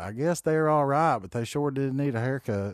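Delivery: high, loud Southern lady twang, slowly; mock her Southern drawl, up high and loud. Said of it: "making fun of people with Southern accents".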